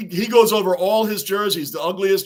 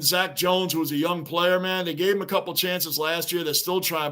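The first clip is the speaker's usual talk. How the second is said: ordinary delivery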